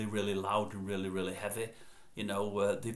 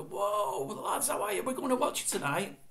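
high-pitched, re-enacted fan greeting; acted-out excited hail; excited calling out; 12 semitones higher, 4 dB louder than usual. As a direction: call up to the band, thrilled and high. Acted greeting called up to band.

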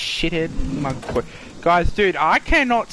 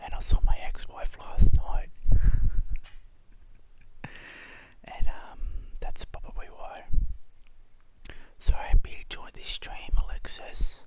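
soft whisper with long pauses; 5 dB quieter than usual. Pretend. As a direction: keep whispering to the viewer, haltingly. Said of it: "because I'm whispering at the moment".

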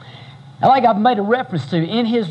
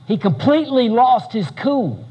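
usual speaking voice

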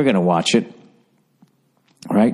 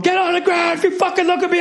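mock cop yell. Cops raiding drug dealer's house.

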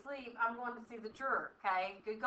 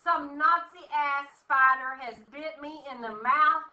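indignant loud ridicule; mock outrage; 4 semitones higher, 9 dB louder than usual. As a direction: scoff loudly at the spider theory. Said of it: "I said".

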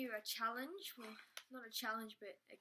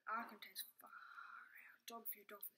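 whispering; 7 dB quieter than usual. Real, not acted. Hushed guess while eating.

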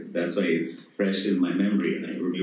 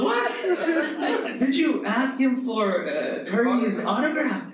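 put-on excited kid shout; 13 semitones higher than usual. Son hearing about Golden State Warriors, excited high yell. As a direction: jump on it like a fan kid. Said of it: "He starts screaming".

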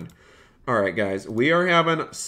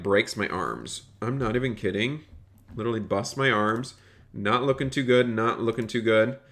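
ordinary delivery

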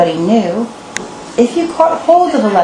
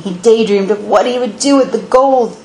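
ordinary delivery

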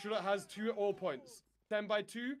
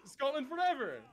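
higher, rousing echo of a shout; imitated battle cry; 6 semitones higher than usual. Player repeating a shout to his regiment, rousing cry. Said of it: "did you just hear that shout".

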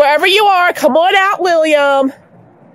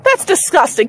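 normal talking voice

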